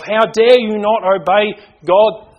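high, loud, drawn-out indignation; acted burning anger. Father voicing his anger at disobedient children, acted outrage. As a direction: thunder it slowly like an outraged parent. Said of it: "the anger burns within me".

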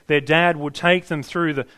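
normal talking voice

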